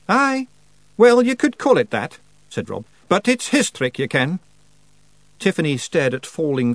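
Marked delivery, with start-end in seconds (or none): 0.1-2.2 very high, loud dialect voice; Rob Anybody. Acted. Rob half agrees with Tiffany, very high dialect voice. 3.1-4.4 high dialect voice, dropping at the end; Rob Anybody. Acted. Rob sets Tiffany straight, high dialect voice.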